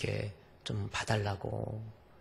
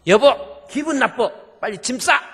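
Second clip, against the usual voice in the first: shouted much higher and louder, hammed-up; mock spouse quarrel; 13 semitones higher, 15 dB louder than usual. Preacher acts a husband snapping at his wife, mock shout. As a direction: playfully yell at your spouse to pack.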